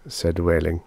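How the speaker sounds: ordinary delivery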